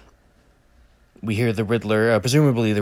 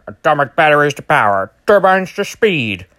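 louder, higher campy hero announcement; Adam West Batman; 5 semitones higher, 7 dB louder than usual. Retold or imitated. Batman launching the Batmobile, campy and loud. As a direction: proclaim it like sixties TV Batman. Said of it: "Batman's in there going".

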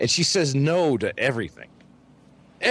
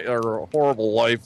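ordinary delivery

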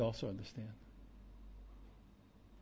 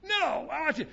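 mock angry outburst; 14 semitones higher, 6 dB louder than usual. Angry person mid-argument, mocked.

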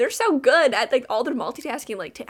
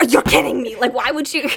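outraged grandma; 2 semitones higher, 8 dB louder than usual. Retold or imitated.

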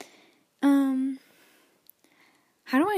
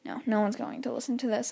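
ordinary talk

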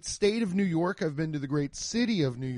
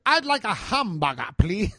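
put-on old German customer; 4 semitones higher than usual. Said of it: "not gonna do the voice".

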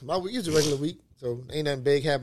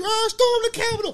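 mock rioter yell. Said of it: "All you hear was".

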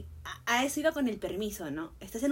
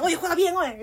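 high, loud mock sideline yell; fired-up urging; 6 semitones higher, 7 dB louder than usual. Player telling a teammate to play well, fired-up. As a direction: get on a teammate's case, playfully acted. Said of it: "soy como que".

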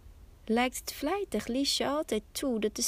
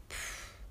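huff; 12 dB quieter than usual. A narrator's reading. Narrator huffs as lazy Liesje.

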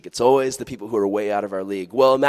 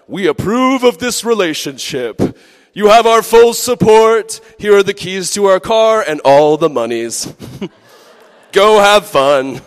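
hammed-up proud parents; grand approving parents; 8 semitones higher, 9 dB louder than usual. Parents voiced granting approval.

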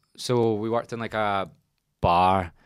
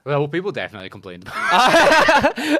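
laughing; 11 semitones higher, 12 dB louder than usual. Real, not acted. Laughing about customer complaints.

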